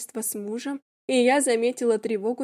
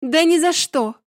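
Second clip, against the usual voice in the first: defiant; 5 semitones higher, 7 dB louder than usual. A narrator's reading. Narrator's defiant exclamation.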